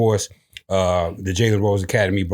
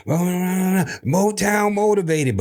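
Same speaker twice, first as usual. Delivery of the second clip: drawn-out catchphrase; 8 semitones higher, much slower than usual. Acted.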